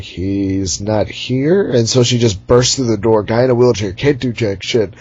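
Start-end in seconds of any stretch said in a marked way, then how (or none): 0.0-1.7 very slow, dragged reply; slow flat reply. Retold or imitated. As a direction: drag every word out. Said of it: "the guy in the wheelchair is like".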